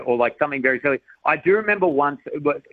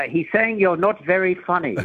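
lightly voiced posh English woman, higher pitch; a higher-pitched, mildly posh English put-on; 6 semitones higher than usual. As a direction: imitate a posh Englishwoman translating a heckle. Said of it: "this very posh English woman".

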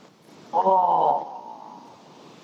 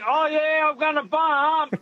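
playful singsong quote; 7 semitones higher, slower than usual. Retold or imitated. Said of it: "You know".

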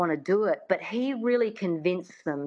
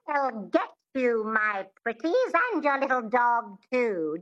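twangy witch voice, pitched up; Wicked Witch; 4 semitones higher than usual. Shown as a demonstration. Coach demonstrating twang, Wicked Witch voice. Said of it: "Think of the Wicked Witch from The Wizard of Oz".

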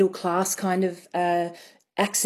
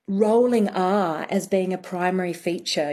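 ordinary delivery

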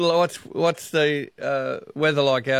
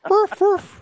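playful dog bark; 5 dB louder than usual. Acted. Host barks for doggy song.

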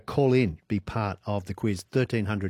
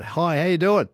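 higher, louder called-out greeting; mock yell; 7 semitones higher, 5 dB louder than usual. Radio host reading a listener's text about greeting a whale, mock yell. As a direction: call it out like hailing someone. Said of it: "and I yell out".

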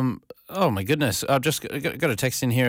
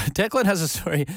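laughing; 8 semitones higher than usual. Real, not acted. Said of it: "laugh heard on line".

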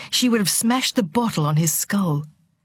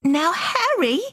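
high, feeble squeak of protest; 11 semitones higher, slower than usual. A narrator's reading. Timid mother protesting to her angry husband, feeble squeak. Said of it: "a feeble squeak of protest".

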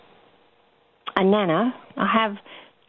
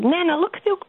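put-on high little-girl voice, much higher; imitating a toddler; 9 semitones higher than usual. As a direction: call out like an eager toddler. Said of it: "And she said".

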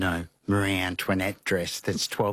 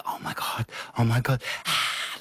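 excited whispering; 4 semitones higher than usual. Retold or imitated. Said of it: "we're like".

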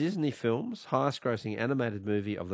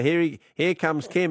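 raised voice, mock alarm call; loud, higher, teasing announcement; mock warning; 5 semitones higher, 6 dB louder than usual. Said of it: "I mean, people say".

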